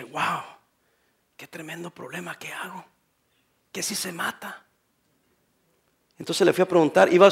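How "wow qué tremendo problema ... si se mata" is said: breathless whisper, worried; panicked whisper. Retold or imitated. Young pastor retelling his panic after the call, whispering. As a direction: whisper it as if scared stiff.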